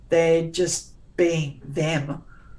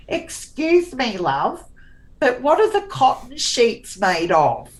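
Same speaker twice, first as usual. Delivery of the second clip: lightly voiced silly customer, higher; 7 semitones higher, 3 dB louder than usual. Son mocking a customer at the linen shop, a bit higher. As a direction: ask like a clueless customer at the linen counter. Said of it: "the way he delivered it".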